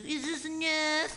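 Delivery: slow, high put-on voice; mock pleading hippie. Host mimics hippies offering twenty-five dollars, slow and high. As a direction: draw it out as a silly imitation.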